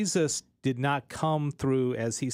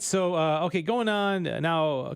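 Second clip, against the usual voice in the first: usual speaking voice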